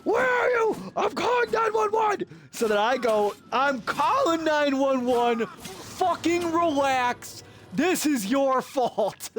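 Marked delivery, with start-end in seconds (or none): 0.0-2.2 much higher, shrieking mock yell; screaming brother. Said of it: "like, screaming on the phone going". 3.5-9.3 exasperated snap. Retold or imitated. Snapping back at panicking brother.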